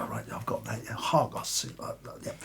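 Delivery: muttered whisper. Acted. Actor mutters rehearsing line.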